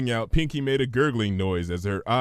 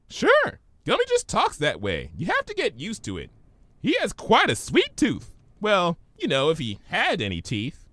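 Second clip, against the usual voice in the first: much higher, bubbly pony; Pinky's voice; 8 semitones higher than usual. Acted. Pinky puzzled by Twilight's big word, high voice.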